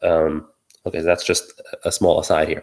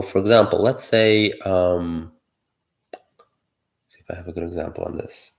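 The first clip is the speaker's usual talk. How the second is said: normal talking voice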